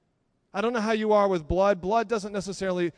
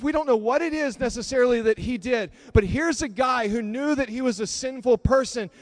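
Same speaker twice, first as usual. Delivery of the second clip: ordinary talk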